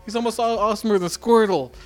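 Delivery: put-on Brooklyn accent. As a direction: rant like a Brooklyn radio caller. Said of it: "the Brooklyn accent all of a sudden".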